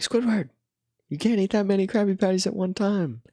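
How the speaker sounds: high, squeaky cartoon sponge scolding a friend; SpongeBob voice. Retelling SpongeBob warning Squidward through the door, high cartoon voice. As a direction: pitch it way up and wag a finger with the voice. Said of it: "he's like".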